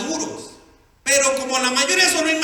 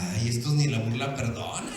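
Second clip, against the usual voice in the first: low, soft mock aside; Sara, amused; 12 semitones lower, 6 dB quieter than usual. Preacher as Sara scoffing at the promise, low amused aside. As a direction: lightly imitate someone laughing to herself. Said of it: "Sara se rió".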